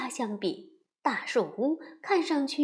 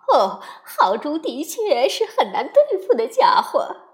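loud, lightly voiced lady muskrat; a hint of a fussy animal lady; Miss Muskrat voice; 3 semitones higher, 8 dB louder than usual.

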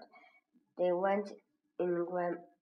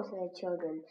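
usual speaking voice

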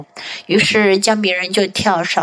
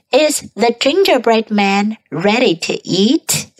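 ordinary delivery

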